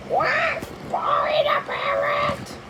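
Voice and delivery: squeaky high parrot squawk, slow. Pretend. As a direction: chirp it like a pet parrot. Child reacts in parrot voice.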